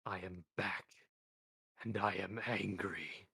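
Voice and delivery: slow, measured voice of Death; Death's voice. Acted. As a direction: let each word fall slow and cold.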